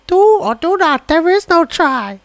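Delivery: slow, rising to a high strained Yoda croak; bad Yoda voice. DJ to co-host, doing a bad Yoda impression. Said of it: "Sorry, the accent was so bad".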